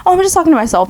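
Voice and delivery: a hint of mom brushing off the question; amused mother. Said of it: "she'll just laugh, like, funny".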